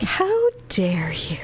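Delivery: slow, whispery mock outrage; mock indignation. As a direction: whisper it slowly, as if scandalized. Mock outrage at being called out.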